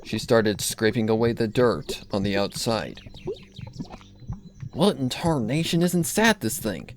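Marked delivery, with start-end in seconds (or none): 4.7-6.9 lightly voiced country mare, puzzled; puzzled farm pony muttering to herself; Applejack voice. Applejack voiced in narrated story.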